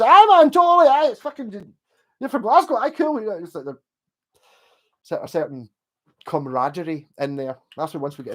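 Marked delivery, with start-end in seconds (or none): 0.0-3.2 high, loud, cheery aye man; excited friendly local. Retold or imitated. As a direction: burst out like a delighted local. Friendly Liverpudlian reply acted.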